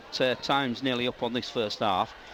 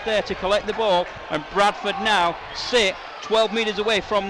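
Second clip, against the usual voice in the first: louder, higher, rising rugby commentary as Bradford press; live play-by-play; 4 semitones higher, 4 dB louder than usual. Acted. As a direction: call the attack near the line with building urgency. Live call of Bradford attack.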